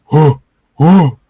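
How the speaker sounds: loud, high mock caveman "whoa"; caveman grunt. Wizard gapes at the fire he started, loud caveman whoa. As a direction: blurt it out like a dim caveman. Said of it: "talking like a caveman".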